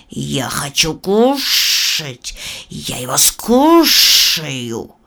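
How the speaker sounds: slow, hissing snake woman voice. Acted. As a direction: draw it out like a sly serpent. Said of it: "А змея в ответ прошипела".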